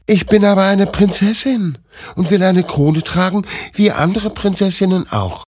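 high princess voice, insisting; insistent princess. Acted. Princess insists to old woman on a crown, high stubborn voice. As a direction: protest as a young princess, pitched high.

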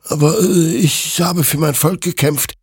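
high, agitated excuse; flustered self-justification. Acted. Hector justifies his killing to the creature, high and defensive. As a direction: plead your case anxiously. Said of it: "rechtfertigte sich Hector".

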